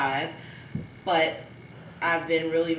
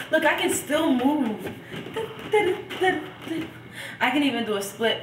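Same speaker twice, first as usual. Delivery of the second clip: excited; 9 semitones higher than usual. Real, not acted. Showing off moves.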